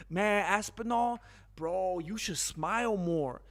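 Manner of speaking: a light mock sweet-talker; lightly voiced flattering promoter; sweet-talking Eddie Hearn. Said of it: "that's how Eddie Hearn's talking to him".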